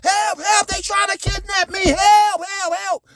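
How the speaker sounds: screaming for help. Retold or imitated. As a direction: yell help like you're being kidnapped. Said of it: "screaming and hollering".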